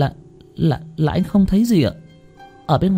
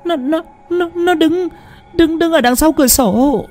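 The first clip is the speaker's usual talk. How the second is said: high, stuttering, pressing every word; insistent terrified stammer. A narrator's reading. Wife face buried in husband's shoulder, insisting in high stammer. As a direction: insist through panic, tripping on words. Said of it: "mà nhấn mạnh".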